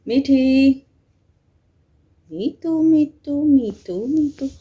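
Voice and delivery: high, sing-song calls of a pet name; sing-song calling. Real, not acted. Mother calling sleeping baby.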